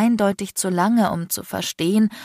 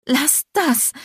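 higher, choked plea; tear-choked croak; 8 semitones higher than usual. A narrator's reading. Hurt woman begs the man to stop, tear-choked. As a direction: plead through held-back tears. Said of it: "krächzte ich tränenerstickt".

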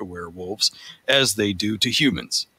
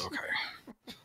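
breathy whispered okay, barely heard; 18 dB quieter than usual. Real, not acted. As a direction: drop to a whisper for a quick aside. Whispered aside after reading a post.

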